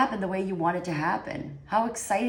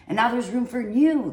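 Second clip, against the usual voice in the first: higher and louder, uplifted; excited; 6 semitones higher, 6 dB louder than usual. Real, not acted. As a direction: lift it with joy. Uplifting tarot reading.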